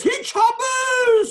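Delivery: high, slow, shouted "hit Shabbos" again; wailing cry. Retold or imitated. Rabbi imitating the rebbe at the wedding, wailing shout. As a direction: repeat "hit Shabbos" as a drawn-out wail. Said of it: "That's the way he said it".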